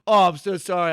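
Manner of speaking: acted-out sheepish sorry; flustered apology. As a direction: blurt the apology as your embarrassed self. Ticklish customer apologizing, retold.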